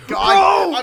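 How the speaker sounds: high, loud laughing cry of frustration; exasperated laugh-shout; laughing exasperation. Real, not acted. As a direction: crack up while groaning at him.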